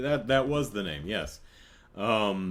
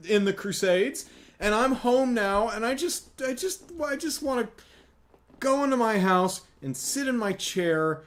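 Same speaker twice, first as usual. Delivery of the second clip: high, whining knight pleading; high whiny complaint to Death; whiny crusader; 10 semitones higher, 4 dB louder than usual. Retold or imitated. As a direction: plead like a worn-out knight begging to go home.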